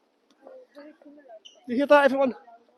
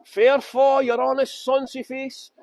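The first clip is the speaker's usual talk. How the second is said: slow, louder poem recital; Burns recitation; 3 semitones higher, 4 dB louder, slower than usual. Shown as a demonstration. Tour guide showing off his Burns to viewers, slow recitation. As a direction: declaim Burns in broad Scots, drawing it out. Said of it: "I can talk Burns and everything".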